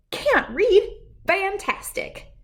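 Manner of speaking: high, delighted squeal of surprise; thrilled a dog can't read; excited bird. A narrator's reading. Bird thrilled to have a new pupil, high and delighted. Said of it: "She waved a wing".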